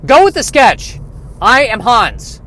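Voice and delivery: high, loud mock outburst; insistent action hero. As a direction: overrule your partner at a hammed-up shout. Acted quarrel, hero insists on sketch.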